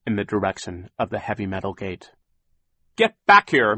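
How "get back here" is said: loud, high commanding yell; shouting. A narrator's reading. Mister Poe calls after fleeing villains, shouting. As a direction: yell after someone getting away. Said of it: "Mister Poe shouted".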